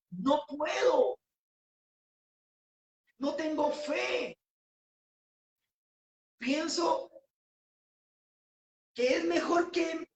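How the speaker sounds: slow hopeless voice that breaks into a cry at the end; despairing believer. Retold or imitated. A despairing believer tells the preacher he has no faith left, crying out. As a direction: say it as if giving up on life. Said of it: "Quizás tú puedes decir en esta noche".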